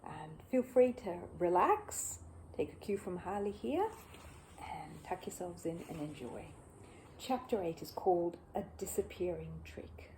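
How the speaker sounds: hushed, half-whispered welcome to listeners; soft bedtime intro. Acted. Reader welcoming listeners to bed, hushed and soft. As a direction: settle the listeners in gently.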